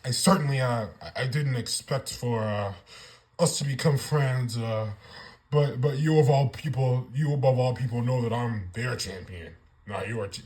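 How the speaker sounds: low, hesitant mock Cody with halting uhs; mocking Cody Rhodes. Vlogger mocks Cody Rhodes' unsure reply to The Rock. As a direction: imitate him sounding unsure. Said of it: "I hate the way Cody Rhodes talks".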